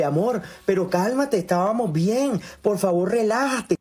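ligera voz aguda de novia que suplica; novia suplicante. Retold or imitated. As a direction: imita suave a la novia rogando calma. (novia que ruega calma, voz aguda ligera)

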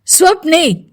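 high-pitched, angry shout of a name; angry call. A narrator's reading. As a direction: call out crossly, pitch jumping up. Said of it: "గట్టిగా కోపంగా VJ పిలుపు". (కోపంగా గట్టి పిలుపు)

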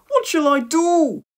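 high, loud, drawn-out plea; distressed cry. A narrator's reading. Boy can't get downstairs through smoke, distressed cry. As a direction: wail it slowly in high dismay. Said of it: "he cried".

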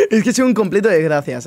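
high laugh then words through laughter; self-mocking laugh after failing again; laughing at himself. Real, not acted. Laughs at own failure.

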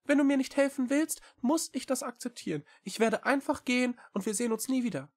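very high, quick small-creature voice; little cat Echo, earnest. A narrator's reading. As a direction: plead eagerly in a high, fast voice. Cat threatens to leave. (hohe, eilige Katerstimme)

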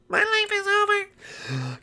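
very high, slow falsetto lament; drawn-out, hammed-up misery; squeaky despair. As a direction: wail it softly in a tiny cartoon voice.

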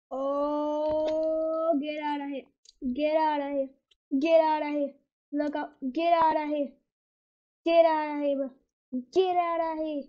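yelling get out of here in panic; loud frantic repeated shouting; panicked yelling. Real, not acted. Panicked yelling during game chaos.

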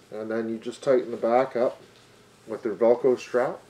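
muffled behind cloth mask; speaking with covered mouth; talking through face mask. Real, not acted.

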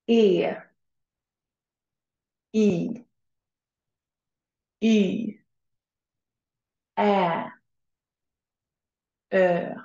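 long soft vowels "E, I, Y, Ä, Ö" modelled slowly and clearly; Swedish soft vowels. Shown as a demonstration. Teacher to class, modelling soft Swedish vowels to repeat. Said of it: "Say after me".

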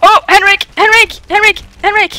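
urgent, high, repeated name calls; calling the name over and over in alarm; panicked calling. Real, not acted. Calling partner for help in panic.

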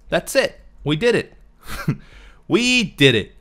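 high, loud celebrating; excited. Real, not acted. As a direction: cheer the win. Finishing the tricky build.

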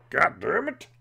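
angry outburst. Real, not acted. Gamer snaps at his own bad play, loud curse. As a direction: let the frustration burst out.

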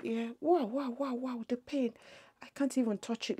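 usual speaking voice

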